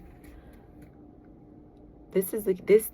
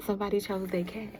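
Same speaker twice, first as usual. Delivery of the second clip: quick teasing whisper; 3 dB quieter, faster than usual. Real, not acted. Tarot reader whispering a teasing aside to viewers.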